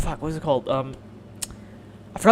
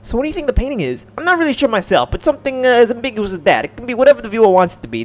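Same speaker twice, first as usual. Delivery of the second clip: put-on a light French accent, pitched higher; 5 semitones higher, 4 dB louder than usual. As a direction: speak like a gracious French lady. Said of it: "is she French?".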